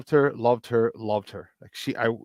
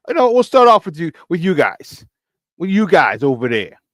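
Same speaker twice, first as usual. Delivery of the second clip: light put-on Chicago accent, punched louder; 3 semitones higher, 7 dB louder than usual. Host opening the sports segment with his fans, playful Chicago accent. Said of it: "All right, my Chicago accent".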